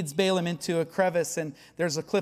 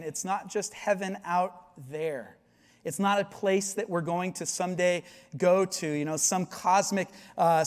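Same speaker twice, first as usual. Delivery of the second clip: ordinary delivery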